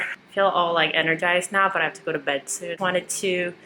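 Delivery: usual speaking voice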